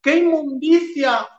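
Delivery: high, hammed-up whiny wail; mock whimpering. Mocking Turrión as a crybaby over an insult, hammed-up whine. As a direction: parody a crybaby moaning. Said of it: "estés ahí lloriqueando".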